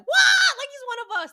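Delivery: thrilled fans claiming him as their own; excited what. Retold or imitated. Jamaican women online hear him name a Jamaican meal, thrilled.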